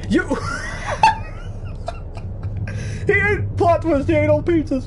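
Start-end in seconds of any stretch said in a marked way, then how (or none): 0.3-3.0 shrieking burst of laughter. Real, not acted. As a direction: explode into high laughter.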